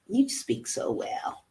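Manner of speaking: breathy, slow, mocking whisper. Echoing the backhanded compliment in a slow mocking whisper.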